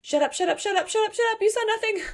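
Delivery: rapid high-pitched repeated shushing, playful; acting out flustered, rising urgency; frantic hushing. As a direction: shut him up in a mock panic. Ivy silencing the witness, acted.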